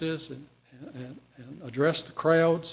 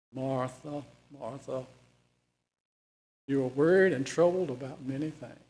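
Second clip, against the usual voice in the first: calm; 3 semitones lower than usual. Acted. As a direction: gently settle her down. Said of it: "he would have said that calmly".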